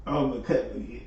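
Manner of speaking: ordinary talk, nothing marked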